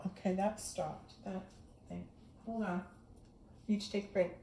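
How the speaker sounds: soft aside before a break; quiet aside. Real, not acted. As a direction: drop the volume, half to yourself. Off-hand aside when something stops.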